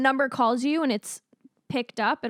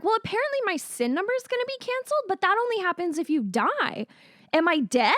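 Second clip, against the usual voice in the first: high, quick faux-naive; mock innocent; 8 semitones higher than usual. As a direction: feign confusion, bright and quick. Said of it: "And I was like".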